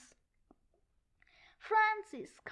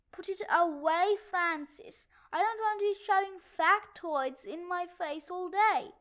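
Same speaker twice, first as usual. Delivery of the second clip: usual speaking voice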